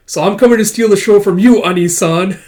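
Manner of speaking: high, loud taunting boast; cocky challenge. Acted. Akane boldly challenging her senior before the show, loud and high. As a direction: throw down the gauntlet with a grin.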